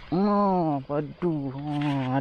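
ordinary talk, nothing marked

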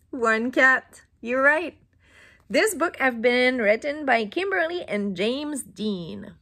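ordinary delivery

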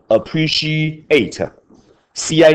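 Appreciate said slowly, C I spelled letter by letter; teacher slowly spelling out C I in Appreciate; slow spelled-out demo. Shown as a demonstration. Teacher spelling appreciate for class, slow and careful. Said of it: "念成嘘嘘嘘".

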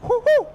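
very high loud hoo hoo; excited hoots. Real, not acted. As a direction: hoot like an owl. Excited hooting.

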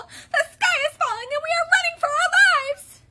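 very high, loud strained chick; Chicken Little. A narrator's reading. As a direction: squeal it in worn-out panic. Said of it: "rasped Chicken Little".

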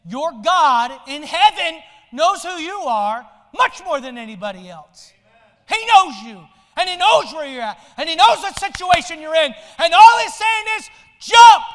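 preacher rising to a thundering climax; crescendo preaching. Acted. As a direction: build the call to a roar.

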